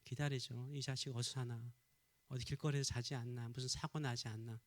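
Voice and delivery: low, quiet fretting to himself; worried father. Acted. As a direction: voice a father's anxious thoughts, low and soft. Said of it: "문 닫아놓은 그 아버지의 마음은 어때요?". (걱정하는 아버지의 낮은 혼잣말)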